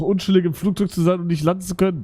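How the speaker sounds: usual speaking voice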